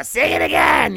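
high, loud mocking bark; mock demand. Diner customer ordering the waiter to sing, loud demand. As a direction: shout it like a rude diner customer.

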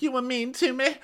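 very high mock-hurt squeak; Jennifer. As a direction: whine it in a squeaky caricature. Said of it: "And so she's like".

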